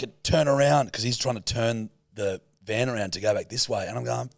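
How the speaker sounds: usual speaking voice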